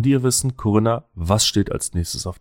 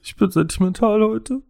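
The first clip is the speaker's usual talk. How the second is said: higher, half-laughing mock weeping; laughing into sobs. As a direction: fake tearful whimper. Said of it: "weinst du jetzt schon wieder".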